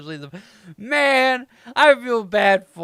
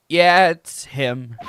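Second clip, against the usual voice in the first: ordinary talk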